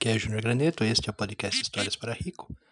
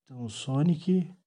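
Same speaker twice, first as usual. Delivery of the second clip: story narration. A narrator's reading. Father narrates Sonic story.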